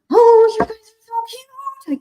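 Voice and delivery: high, slow gushing squeal; overwhelmed by cuteness. Real, not acted. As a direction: melt over how cute they are. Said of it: "I can't handle the cute".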